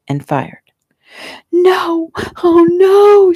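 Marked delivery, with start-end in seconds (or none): 1.5-3.3 very high, wailing scream; horrified scream. A narrator's reading. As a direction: scream out in horror. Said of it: "Shelley screamed".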